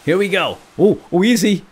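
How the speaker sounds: high, loud, frantic urging; tense, high-pitched climbing panic; urgent cheering on. Real, not acted. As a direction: shout him onto the rope. Urging character across a risky climb.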